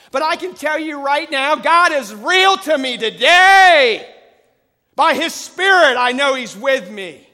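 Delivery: loud, rising preacher's cry; preaching at full force. Acted. Preacher assuring the congregation God is always there, full-force preaching. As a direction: drive it home to the congregation.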